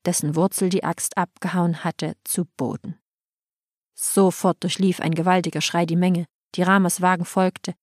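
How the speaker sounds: plain reading voice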